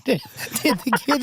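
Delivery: laughing. Real, not acted. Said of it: "It sounds like you euthanized the children".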